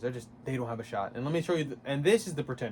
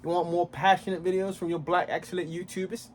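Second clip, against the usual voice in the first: higher, louder mock Australian accent; hammed-up Australian accent; 6 semitones higher, 5 dB louder than usual. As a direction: ham up an Australian outro. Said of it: "Why am I doing an Australian accent?".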